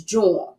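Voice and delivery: "jaw" said the Philly way, as jawn; Philly slang pronunciation. Shown as a demonstration. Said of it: "I'm gonna teach you our famous word".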